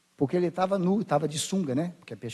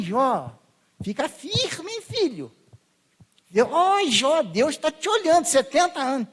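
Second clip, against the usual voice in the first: mock cheerful consolers; 5 semitones higher than usual. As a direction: ham up the empty cheering of a friend. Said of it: "o pessoal quer que ela fique assim". (zombando dos consoladores, voz mais aguda e animada)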